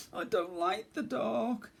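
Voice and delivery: high small timid voice; small scared bear cub. Acted. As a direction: speak as a frightened little cub.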